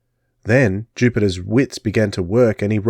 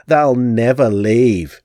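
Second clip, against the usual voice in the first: gloating crone; slower than usual. Acted. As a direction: gloat with false mercy.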